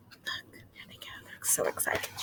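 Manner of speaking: hushed whisper pointing at the page; teacher whispers about the excited relatives. Real, not acted. Whispered aside about the picture.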